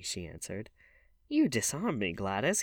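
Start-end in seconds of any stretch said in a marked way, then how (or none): 1.3-2.6 exclaimed with a lift in pitch; a louder, higher opening cry. A narrator's reading. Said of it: "he cried".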